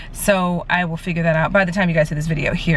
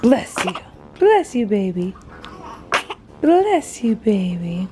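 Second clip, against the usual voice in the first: high cooing bless you; mother cooing at her newborn; 4 semitones higher, much slower than usual. Real, not acted. Mother to sneezing baby.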